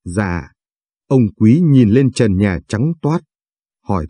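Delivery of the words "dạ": soft, low little reply; timid, hushed murmur; small timid voice. A narrator's reading. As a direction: answer meekly, barely speaking up. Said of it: "Thu lý nhí".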